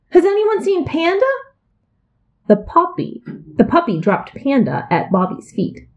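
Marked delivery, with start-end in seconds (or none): 0.1-1.2 put-on high little-girl call, searching; sister calling out. A narrator's reading. Little sister calls out for her missing Panda, high. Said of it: "his sister cried".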